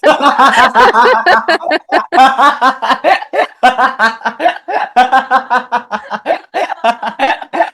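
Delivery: laughing repeat. Real, not acted. Laughing echo of host's joke.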